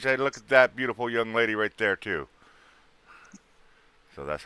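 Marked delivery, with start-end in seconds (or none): none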